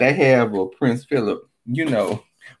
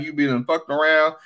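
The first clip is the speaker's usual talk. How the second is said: usual speaking voice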